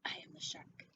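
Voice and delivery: breathy hushed whisper of the line; a soft unvoiced whisper. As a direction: whisper it like a secret. Whispered repeat of the title.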